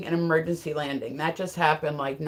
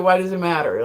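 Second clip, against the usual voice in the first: ordinary delivery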